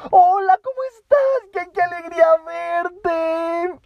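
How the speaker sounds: very high, loud squeal of delight; overacted giddy greeting from the head; exaggerated joy. Shown as a demonstration. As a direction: gush with over-the-top happiness. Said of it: "aunque lo haya hecho muy exagerado".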